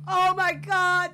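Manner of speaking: high, loud, drawn-out groan of words; exasperated cry. Real, not acted. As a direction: cry out slowly in dismay. Exasperated at game's disasters.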